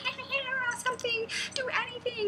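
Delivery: high squealing bird plea; comic panicky finch voice; imitated mother finch. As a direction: plead in a flustered falsetto chirp. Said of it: "And she's like".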